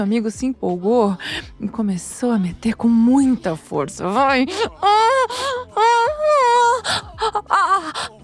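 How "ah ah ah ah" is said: high, short moaned cries; acted moans of pleasure. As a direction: moan high in quick bursts. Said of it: "eu gemia de tanto tesão".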